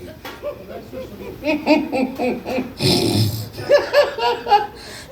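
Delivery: high, wheezing cackle that runs out of air; breathless laughing fit. Retold or imitated. Comic showing how his friend laughed, gasping and squeaking. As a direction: imitate a friend helpless with laughter. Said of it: "'Cause he's doing this".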